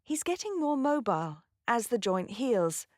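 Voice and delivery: British pronunciation, MOBILE ending like "mile"; British accent. Shown as a demonstration. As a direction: say it as a Brit, with MOBILE as "MOH-bile". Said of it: "In a British accent".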